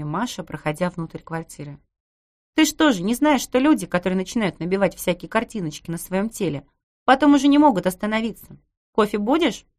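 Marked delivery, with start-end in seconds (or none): 2.5-9.7 high, loud teasing neighbour; cheeky neighbour voice. Acted. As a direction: voice the brash neighbour, mocking. Narrator voices Masha.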